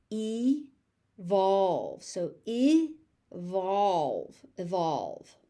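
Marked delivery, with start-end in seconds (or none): 0.1-2.0 "Evolve" as ee, pause, volve; syllable by syllable. Shown as a demonstration. Teacher sounding out "Evolve" for learners, syllable by syllable. 2.5-4.4 "evolve" slowly as ih, pause, volve; short first vowel, long second beat in "evolve"; syllable by syllable. Shown as a demonstration. Teacher sounding out "evolve" for learners, slowly by syllable.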